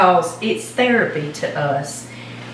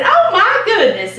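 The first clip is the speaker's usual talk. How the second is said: high, laughing oh my goodness; delighted laugh; 11 semitones higher, 4 dB louder than usual. Retold or imitated. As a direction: gush with a laugh, flattered. Delighted reaction, laugh heard.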